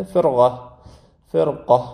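فرقه, the ر kept heavy before the ق; فرقه said firmly with a full ر. Shown as a demonstration. Teacher repeating فرقه after calling it hard. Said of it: "سخته".

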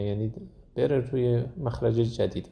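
ordinary talk

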